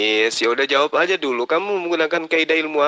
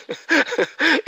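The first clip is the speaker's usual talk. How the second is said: scoffing high laugh; 14 semitones higher than usual. Real, not acted. Scoffing at the answer.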